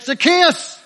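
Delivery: loud, high-pitched shout of a name. Acted. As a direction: shout it up over the chatter. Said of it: "the Lord Jesus shouted out".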